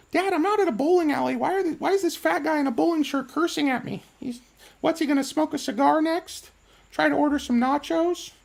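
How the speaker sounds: put-on high, fast childish complaint; mock a five-year-old whining at dad; little daughter voice. Streamer voicing a daughter complaining to her dad, high childish whine. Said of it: "she's like".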